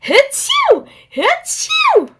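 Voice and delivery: very high, loud fake sneezes; mock sneezing. Dizzy teases the pig, with mock sneezes. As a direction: mimic the pig's sneezes. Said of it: "you're going".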